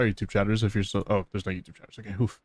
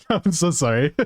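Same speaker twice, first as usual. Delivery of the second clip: giggling "I'm so sorry" then a high laugh; can't stop laughing while saying sorry; laughing apology; 7 semitones higher than usual. Real, not acted. Streamer apologizing to friend he attacked, laughing.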